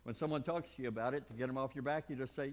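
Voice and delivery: normal talking voice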